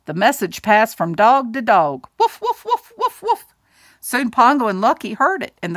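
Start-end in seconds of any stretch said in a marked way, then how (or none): 2.2-3.5 high, yappy woofs; playful dog barking. Acted. The message passed dog to dog, high eager barking. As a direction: bark it like an eager little dog.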